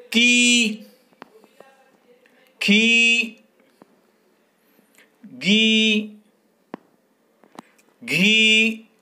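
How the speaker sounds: की खी गी घी called out high and loud, each held on the long ई; drilled syllables. Shown as a demonstration. Teacher drilling pupils on the ई sign, calling out syllables. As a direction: chant each syllable to the class one by one. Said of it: "मिला के पढ़ेंगे तो क्या आएगा?".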